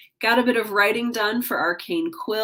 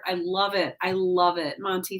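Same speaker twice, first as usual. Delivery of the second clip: ordinary talk, nothing marked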